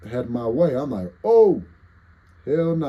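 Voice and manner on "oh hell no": loud high startled yelp; startled panic. Retold or imitated. Streamer seeing the spider come at him, loud panicked yelp.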